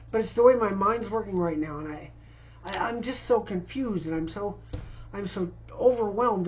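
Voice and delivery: usual speaking voice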